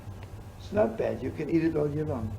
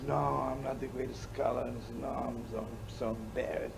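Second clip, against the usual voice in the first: soft, shy self-effacing murmur; humble scholar; 7 dB quieter than usual. Retold or imitated. Humble rabbi quietly refusing praise on stage, softer. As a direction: shrink away from the praise, softly. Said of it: "And he talks like that".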